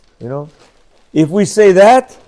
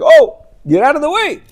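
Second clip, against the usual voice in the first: shooing photographer; 8 semitones higher than usual. Retold or imitated. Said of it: "So he's like".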